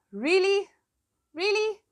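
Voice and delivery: high incredulous really; fed-up disbelief; exasperated disbelief. Real, not acted.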